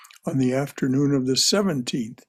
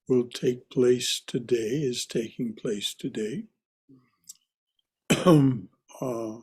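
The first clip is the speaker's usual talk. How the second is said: ordinary delivery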